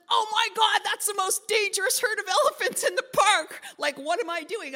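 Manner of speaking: high, loud alarmed cry; alarmed shout. Retold or imitated. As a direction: yell at yourself in disbelief. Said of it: "the guide ... says".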